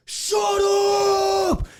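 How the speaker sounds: high, drawn-out yell; mock angry yell. Angry man charging at a woman, imitated yell. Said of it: "comes running over yelling that".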